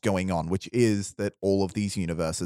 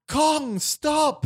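much higher pleading call; Daisy's plea. Acted. Daisy begs Kong to stop fighting, high plea. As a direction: cry out high to stop him.

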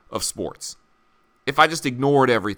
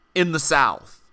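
usual speaking voice

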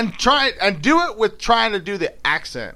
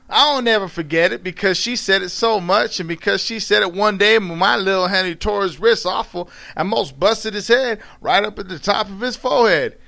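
storytelling in dialect; 4 semitones higher than usual. A narrator's reading. Reading Mark Twain aloud as the character, in Southern dialect. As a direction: read it as an old Southern storyteller.

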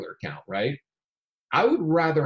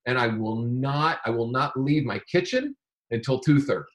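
ordinary talk, nothing marked

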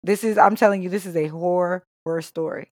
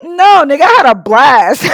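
laughing; 10 semitones higher, 9 dB louder than usual. Real, not acted. Laughing shout, high and loud.

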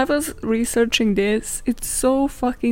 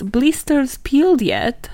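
"Blisters peeled yet?" drawn out slowly with a higher, questioning tune; 4 semitones higher, 3 dB louder, slower than usual. Retold or imitated. Old saying put to lazy people, "Blisters peeled yet?" as a slow question. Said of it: "directed as a question".

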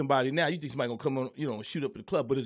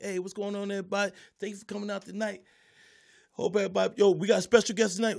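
lightly acted emcee greeting, higher pitch; stage host; 6 semitones higher than usual. Comic on stage greeting the crowd, upbeat host voice. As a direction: replay the host welcoming the crowd.

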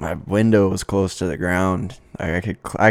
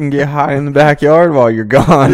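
louder, higher mock teenage defiance; defiant teen; 7 semitones higher, 9 dB louder than usual. Friend voicing a teen refusing his parents, loud and high. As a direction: blurt it out like a cocky teen. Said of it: "You're like".

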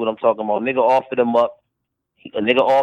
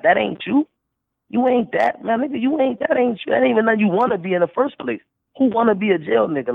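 pitched way up, worked up; heated; 10 semitones higher than usual. Real, not acted. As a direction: get fired up and climb high. Heated rant at rival battler.

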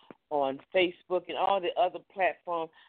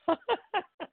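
high-pitched chuckle; short laugh. Real, not acted. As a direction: laugh lightly after wrapping up. Laughs after wrapping up.

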